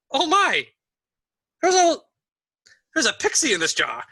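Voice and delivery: put-on high, loud old man's exclamation, easing down; astonished old man. Old man discovers a pixie in a jar, astonished high voice. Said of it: "gristly eyebrowed old man".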